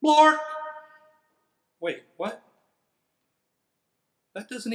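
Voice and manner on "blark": sudden loud nonsense yell, much higher; silly shout. Shown as a demonstration. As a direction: blurt the nonsense word out full force. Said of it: "even if the words say".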